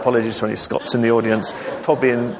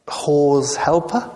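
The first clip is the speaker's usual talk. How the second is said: hesitant clerk; 5 semitones higher, much slower than usual. Acted. Jacob grasps for a word.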